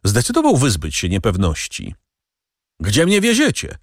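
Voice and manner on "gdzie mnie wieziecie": wysokie, natarczywe pytanie; podniesione pytanie. A narrator's reading. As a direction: zapytaj wyższym głosem, domagając się odpowiedzi. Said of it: "spytał". (pytanie w podwyższonym tonie)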